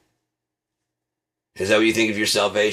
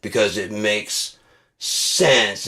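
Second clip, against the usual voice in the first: ordinary delivery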